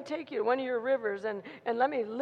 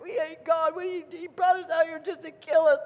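higher-pitched complaining outburst; acted whining; 4 semitones higher, 5 dB louder than usual. People in the tent blaming God, mock whining. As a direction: whine and carry on, louder as it goes. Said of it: "carrying on and whining and crying".

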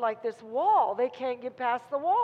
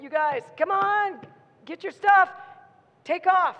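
loud, higher-pitched call to move; shouted announcement; 6 semitones higher, 8 dB louder than usual. Acted. Announcement to the Israelites to get moving, urgent shout. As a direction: call out orders to a crowd. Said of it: "there was an announcement".

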